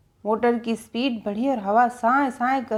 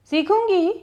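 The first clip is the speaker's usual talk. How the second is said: determined; 8 semitones higher, 3 dB louder than usual. A narrator's reading. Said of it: "मैंने तवज्जुही से फैसला किया".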